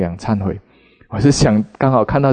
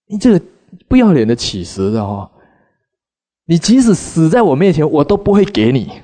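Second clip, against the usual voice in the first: sharp, higher and louder stingy-wife scolding; harsh retort; 6 semitones higher, 3 dB louder than usual. Retold or imitated. Stingy wife slams the door on the begging monk, harsh and loud. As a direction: snap it at the begging monk with contempt. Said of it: "她就狠狠地抛了一句话".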